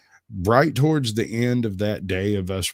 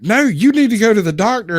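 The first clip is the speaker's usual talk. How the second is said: insisting; 11 semitones higher, 6 dB louder than usual. Retold or imitated. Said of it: "And they're like".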